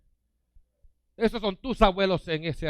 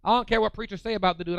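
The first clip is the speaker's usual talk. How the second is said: ordinary delivery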